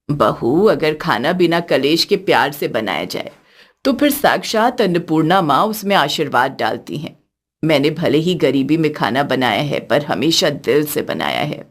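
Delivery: put-on a gentle older woman speaking from the heart; elderly mother-in-law.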